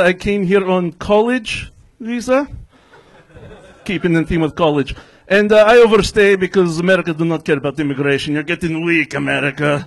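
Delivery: stand-up comic's put-on Russian accent; hammed-up Russian English; fake Russian accent. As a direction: play a Russian immigrant with a thick accent. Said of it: "somebody's doing a fake Russian accent".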